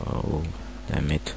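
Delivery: ordinary talk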